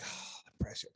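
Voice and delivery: whispered to himself; nervous whisper under the breath. Retold or imitated. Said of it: "So I'm like".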